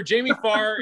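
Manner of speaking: normal talking voice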